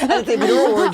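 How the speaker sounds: put-on light Australian accent, laughing; mock Australian accent while laughing. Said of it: "said in an Australian accent".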